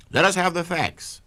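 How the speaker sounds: demanding crowd. A narrator's reading. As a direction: call out for the facts like an eager audience. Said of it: "said the people".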